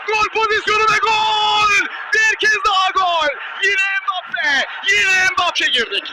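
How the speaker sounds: loud shouted goal call; screaming football commentator. Real, not acted. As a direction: shout it over the crowd.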